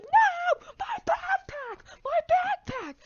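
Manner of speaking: put-on very high, loud shrieking kid voice; panicked kid. Harold wailing at the garbage truck, shrill high panic. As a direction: shriek like a little kid losing his stuff.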